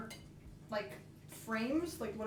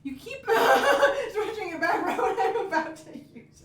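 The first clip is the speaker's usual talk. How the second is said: higher, louder, laughing through it; laughing protest; 9 semitones higher, 8 dB louder, slower than usual. Real, not acted.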